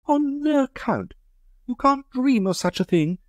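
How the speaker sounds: high, light society lady asking; Amanda's voice. Acted. Amanda objects.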